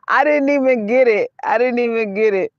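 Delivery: real laughter breaking into the words; laughing. Real, not acted. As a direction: giggle out the line at a joke you missed. Laughing at a joke she missed.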